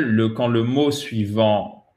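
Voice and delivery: ordinary delivery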